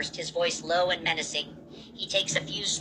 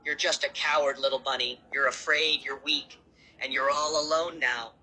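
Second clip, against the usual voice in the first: lower, sneering villain taunt; low and mocking; 4 semitones lower than usual. Monster looming over the rabbit, low and mocking. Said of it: "his voice low and mocking".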